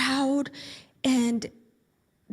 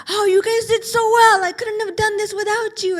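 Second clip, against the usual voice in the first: much higher and louder cheering; excited praise; 9 semitones higher, 7 dB louder than usual. Acted. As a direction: cheer the team on. Celebrating teammates after game.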